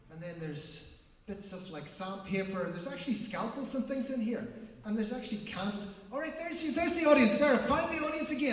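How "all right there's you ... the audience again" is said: high, loud burst of discovery; excited relief. Pretend. Performer finding his lost audience in the box, excited relief. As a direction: burst out with delighted relief, pitch jumping up.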